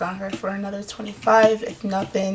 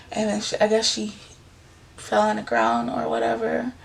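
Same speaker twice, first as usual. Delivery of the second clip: ordinary talk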